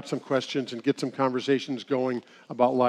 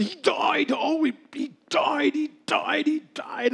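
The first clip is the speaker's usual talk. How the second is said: much higher, wailing cry of he died; mock wailing widow; 11 semitones higher, slower than usual. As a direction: wail it like a grieving widow. Widow cries out husband died.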